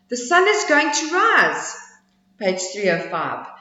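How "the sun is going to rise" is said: high squeaky squirrel voice; little squirrel. Acted. Squirrel questioning the bragging bear, high squeaky voice. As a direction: pipe it up small and high.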